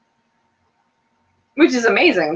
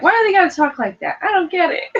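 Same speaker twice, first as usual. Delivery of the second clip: higher, puzzled whine; confused Daniel; 12 semitones higher than usual. Retold or imitated. Daniel baffled by how the British talk, higher puzzled voice. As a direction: sound baffled by the slang. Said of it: "Daniel's like".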